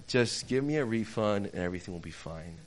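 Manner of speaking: low, soft, restrained appeal; smooth it over, dropping the volume; placating. Retold or imitated. Customer asking the counter man for a refund, quietly placating.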